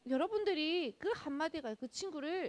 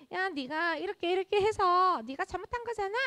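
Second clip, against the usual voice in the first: pointed, higher and louder finger-wagging jab at a friend; a child calling out a friend's fault, louder and accusing; blaming scold; 6 semitones higher, 5 dB louder than usual. Retold or imitated. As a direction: play the kid who rubs the mistake in. Child scolding a friend who erred.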